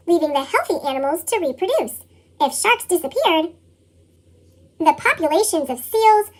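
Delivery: usual speaking voice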